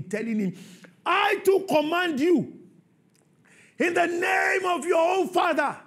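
high, loud sermon delivery; preaching at full force. Acted. Preacher stirring the church about Jesus' command, shouting. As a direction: hammer the point to the congregation.

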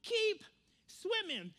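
high, halting, sing-out encouragement; Dory. Acted. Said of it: "And Dory says".

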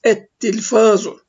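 slow "Et tilfazu" with a held double T; silent lam, doubled T. Shown as a demonstration. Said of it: "şeddeli olarak okunuyor".

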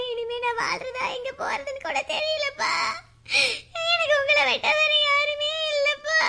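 high, slow tearful pleading; crying. Acted. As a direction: sob it out to your father. Daughter sobs to father.

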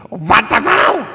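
high, loud screech slipping into German; screamed Germanic cat voice; screaming cat, bit German. Acted. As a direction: shriek it in a mangled Russian-German accent. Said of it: "It's gone a bit German".

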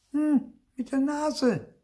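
very high, slow and drawn out; knowing reveal. A narrator's reading. Sister reveals how she kisses aunts, high and knowing. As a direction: let the secret out slowly, high-pitched.

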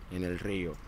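usual speaking voice

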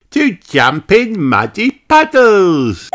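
put-on high, slow cheering child voice; slow excited piglet cheer. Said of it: "cheers Peppa".

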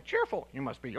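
low, soft Yoda impression. Preacher voicing Yoda's word order, low and soft. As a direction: drop into a lightly voiced Yoda. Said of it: "it's a Yoda thing".

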